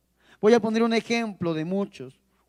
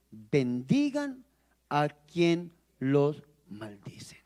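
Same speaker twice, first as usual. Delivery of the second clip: very slow, word by word; slow solemn scripture reading; 5 semitones lower, 3 dB quieter, much slower than usual. Acted. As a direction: spell out "Bendigan a quien los maldice" syllable by syllable. Preacher quoting the Lord solemnly.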